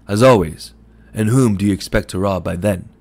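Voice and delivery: loud, scornful jab; scornful retort. A narrator's reading. Shopman mocks Mooney's empty promise, loud and scornful. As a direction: throw his promise back in his face.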